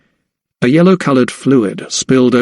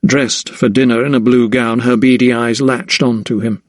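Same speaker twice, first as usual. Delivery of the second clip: usual speaking voice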